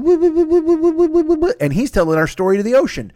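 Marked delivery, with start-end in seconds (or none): 0.0-1.5 repeated woo woo squeals, much higher; high woo calls. Pretend. Aquaman telling their story to the ocean, high woo calls. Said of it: "fucking Aquaman's going".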